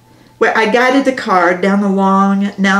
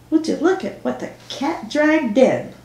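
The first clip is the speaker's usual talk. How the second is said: lilting, higher-pitched jibe through a grin; grinning greeting; 6 semitones higher than usual. A narrator's reading. Cashier to returning customer, grinning jibe. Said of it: "ended with a grin as she said".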